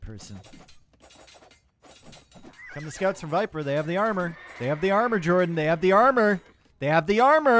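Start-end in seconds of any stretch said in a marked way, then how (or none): 2.7-7.6 building to a loud, high shout; excited casting. Real, not acted. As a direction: hype it up, louder with each repeat. Caster excited about armor, building.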